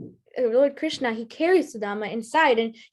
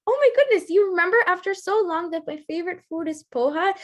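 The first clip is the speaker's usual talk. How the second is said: delighted exclaim; 6 semitones higher, faster than usual. Acted. Krishna delighted by poha gift.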